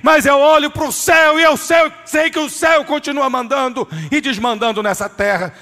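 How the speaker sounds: preaching at full force. Real, not acted. Preacher exhorting the church, in a loud fervent shout. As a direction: proclaim it like a shout of faith.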